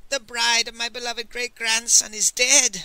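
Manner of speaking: high, loud mock grief; howled lament. A narrator's reading. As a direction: howl it like a theatrical mourner. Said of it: "howled Master Li".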